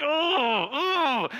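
high hammed-up pained oh; mock wounded whimper. Preacher mocking our hurt reaction, hammed-up whimper. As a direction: overplay being hurt by criticism. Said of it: "every one of us is like".

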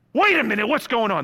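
lightly acted alarmed child; mock freak-out. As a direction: imitate kids starting to panic. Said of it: "They kind of freak out".

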